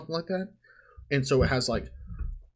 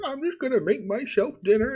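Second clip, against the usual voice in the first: much higher sweet granny imitation; old lady voice; 10 semitones higher than usual. Guest imitating the old widow, high and sweet. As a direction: play the retiree cheerfully announcing dinner. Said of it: "she's just like".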